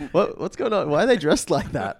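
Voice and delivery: high, mock-confused questioning; baffled. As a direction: act out a confused viewer demanding answers. Imitating baffled friend watching film.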